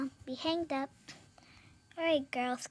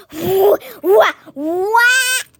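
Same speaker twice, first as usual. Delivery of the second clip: startled shouts; 5 semitones higher, 12 dB louder, much slower than usual. Acted. Startled in pretend play.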